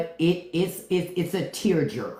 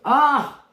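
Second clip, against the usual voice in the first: high, loud yelp of delight; loud cry; 9 semitones higher, 5 dB louder than usual. Real, not acted. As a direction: let out a sudden loud ah. Outburst after laughing.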